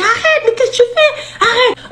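high laughing mock scream; crying out. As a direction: scream arrête half laughing. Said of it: "je crie".

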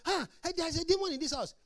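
high, loud mock alarm about a demon; mocking demon panic. Preacher mocking a believer crying demon, high and loud. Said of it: "So some of these things is not because".

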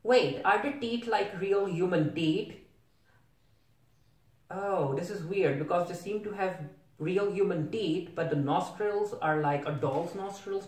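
usual speaking voice

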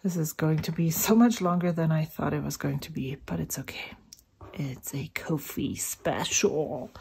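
hushed whisper aside. Real, not acted. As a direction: whisper it under your breath.

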